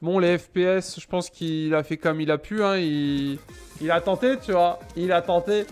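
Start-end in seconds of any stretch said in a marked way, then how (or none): none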